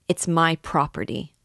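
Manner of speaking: ordinary delivery, nothing marked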